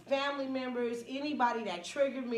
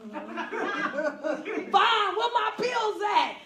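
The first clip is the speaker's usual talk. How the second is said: high, loud yell for the pills; yelling friend; 9 semitones higher, 6 dB louder, much slower than usual. Retold or imitated. Friend to speaker, shouting for her pills. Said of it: "She said".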